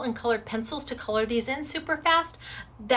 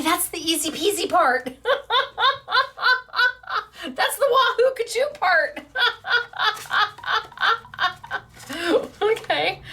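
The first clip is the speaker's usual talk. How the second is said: high giggling words; laughing; 10 semitones higher, 5 dB louder than usual. Real, not acted. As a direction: laugh through the silly rhyme. Laughing at her own rhyme.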